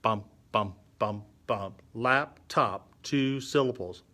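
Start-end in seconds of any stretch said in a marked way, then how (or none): 0.0-1.8 evenly spaced low "bum" beats; spoken drum beats. Shown as a demonstration. Teacher shows a syllable is like a beat, steady spoken beats. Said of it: "a syllable is kinda like a beat". 1.9-2.9 "Laptop" split into two even beats, lap, top. Shown as a demonstration. Teacher likens syllables to music, beat by beat. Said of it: "a syllable is kinda like a beat".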